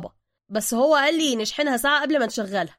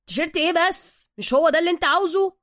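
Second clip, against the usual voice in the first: high, loud defensive question; baffled protest; 8 semitones higher, 7 dB louder than usual. Acted. Suka answering an angry Bruce, loudly protesting. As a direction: protest in puzzled Cairene slang.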